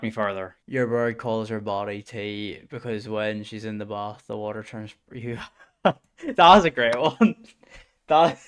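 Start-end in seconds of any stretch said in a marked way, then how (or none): 5.8-8.4 laughing through the words, higher and louder; slow, giggling delivery. Real, not acted. Streamer cracking up at a chat joke, laughing.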